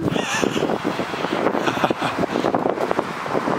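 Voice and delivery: long squealing laugh, pitched very high; high cackling laugh. Real, not acted. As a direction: burst into a shrieking laugh. Laughs after wind joke.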